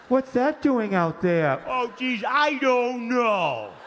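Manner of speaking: slow, puzzled parents' voices; bewildered parents. Retold or imitated. Parents puzzling over the Hamburglar, slow and drawn. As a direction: sound baffled like the parents.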